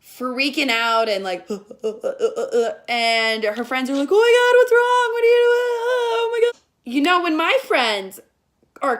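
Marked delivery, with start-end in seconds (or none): 1.5-2.8 rapid stuttering gasps, mock panic; gasping mock convulsion; imitated freak-out gasps. 4.1-6.6 high-pitched, loud panicking friends; hammed-up frantic teenage girls; mock panic.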